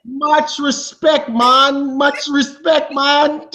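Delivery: playful mock Jamaican shout-out; fake Jamaican accent. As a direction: salute him in a hammed-up Jamaican accent. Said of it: "my Jamaican accent is false".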